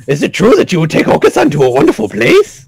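high, eager bird voice; excitable bird character. Acted. Voices the game's excitable bird Oka-san.

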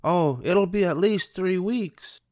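gentle polite reply; Anita. A narrator's reading. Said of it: "said Anita".

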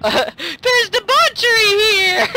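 very high, slow, loud theatrical wail; mock outcry. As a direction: wail it out in over-dramatic horror. Quotes a show in mock outcry.

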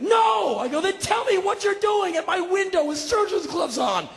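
high, strained confrontation; exasperated demand. Retold or imitated. Homeowner asking about surgeon's gloves, high and exasperated. Acted quarrel with the burglar.

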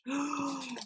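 high, soft gasp; quiet intake of breath, thrilled; delighted gasp. Real, not acted. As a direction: gasp in real amazement. Delighted by better scent.

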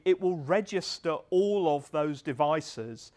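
normal talking voice

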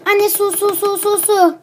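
put-on high, loud whiny child pleading; begging for water. Thirsty daughter begging her mother, high whiny plea.

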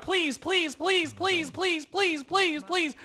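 high, repeated begging; insistent pleading. Real, not acted. Player urging someone to murder a rival, high begging.

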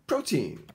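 put-on "Protein" with the first syllable high and strong, falling onto "teen"; English pronunciation of protein. Shown as a demonstration. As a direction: say "Protein" crisply as PRO-teen, stressing PRO. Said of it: "Here is the pronunciation".

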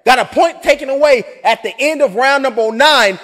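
loud, high, fired-up ringside call; excited boxing call. Acted. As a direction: shout the foul as it happens. Excited end-of-round call.

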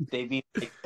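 ordinary talk

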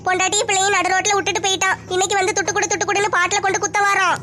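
ordinary delivery

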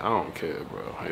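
usual speaking voice

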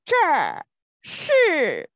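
这是 said loud and distinct, both syllables falling; zhe shi model. Shown as a demonstration. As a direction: demonstrate 这是 carefully for learners. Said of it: "Open your mouth, your tongue to the attic".

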